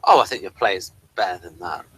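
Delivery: normal talking voice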